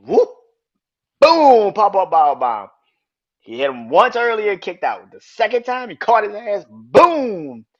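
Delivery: loud, high-pitched play-by-play of the pin; excited match call. Retold or imitated. Recapping the finish for his viewers, loud excited play-by-play.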